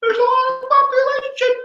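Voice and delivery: very high, sing-song voice; silly squeal. Pretend. Teacher clowning about crowded Virginia, squeaky sing-song. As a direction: squeal it in comic falsetto. Comic aside on Virginia's population.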